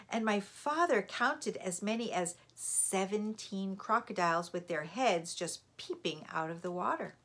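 ordinary delivery, nothing marked

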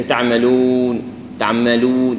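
تعملون with lips firmly rounded on the waw. Shown as a demonstration. Teacher models تعملون with rounded lips. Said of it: "الشفتين يكون منضمات".

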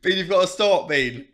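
loud, high pleading; pleading shout. Real, not acted. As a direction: beg him loudly to quit. Pleading friend to stop.

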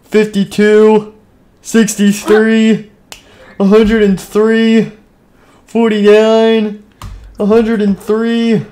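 put-on slow, high dumb-kid count; silly counting. Silly random counting slowly.

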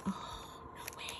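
breath-only, stunned whisper; awed whisper. Real, not acted. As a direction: whisper it in real disbelief. Stunned on seeing her gift.

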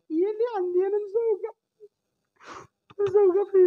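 high, cracking cry; angry crying. Real, not acted. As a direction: voice breaking into sobs. Mother breaks down crying. (بكاء وصوت متقطع)

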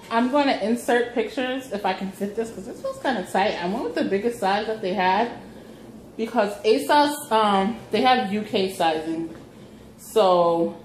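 normal talking voice

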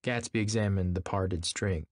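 ordinary delivery, nothing marked

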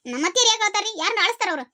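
normal talking voice

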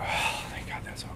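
voiceless, sighing whisper of relief; relieved sigh. Shown as a demonstration. Teacher showing a relieved long exhale, whispered. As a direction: let it all go on the breath. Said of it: "usually they will go".

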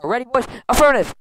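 high, loud command and reply; rallying call. Acted. Bee leader readying the boys for landing, rallying shout. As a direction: call it out to the squad. Rallying the bees to land plane.

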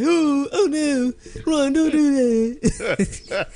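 high, slow, whiny Hermione voice; mock Hermione wail, high and slow. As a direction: squeal it like a fussy schoolgirl. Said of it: "like, Hermione, he's like".

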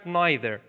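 "neither" starting like "nigh"; NYE-ther pronunciation. Shown as a demonstration. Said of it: "اینجا تلفظش کرد".

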